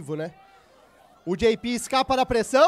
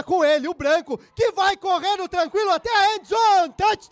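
rising excited touchdown shout; touchdown call; 7 semitones higher, 4 dB louder than usual. Real, not acted. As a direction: build up and explode on touchdown. Narrator calls a touchdown run. (grito de touchdown empolgado)

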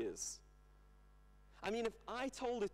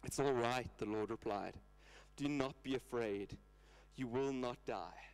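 lower, calm and steady; reassuring God; 6 semitones lower than usual. Retold or imitated. Preacher voicing God comforting Gideon, lower and calm. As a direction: settle him like a gentle father.

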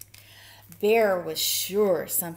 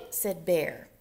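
ordinary delivery

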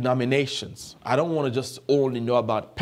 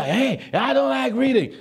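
high-pitched mock complaint; mocking imitation; 10 semitones higher than usual. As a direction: mimic a reluctant reader, pitched way up. Mocks people who won't read.

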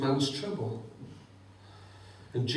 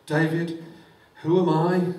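David's exclaimed question; 3 semitones higher, 6 dB louder than usual. Acted. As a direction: exclaim it in awe. David's humble words voiced.

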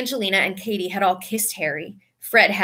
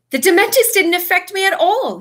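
loud, high, eager burst; excited; 10 semitones higher, 6 dB louder than usual. A narrator's reading. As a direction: blurt it out thrilled. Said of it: "Harry said excitedly".

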